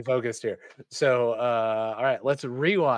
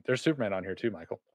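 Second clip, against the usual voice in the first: hiccups; 4 semitones lower, 6 dB quieter, faster than usual. Real, not acted. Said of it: "I have the hiccups".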